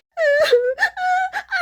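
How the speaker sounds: high, loud hammed-up sob; mock wailing cry. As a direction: wail like a sobbing girlfriend, exaggerated. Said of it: "she would cry".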